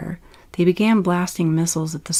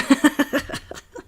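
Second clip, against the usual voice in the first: a burst of high, breathless laughter; real high laugh; 9 semitones higher than usual. Real, not acted. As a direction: crack up laughing mid-story. Reader breaks into laughter.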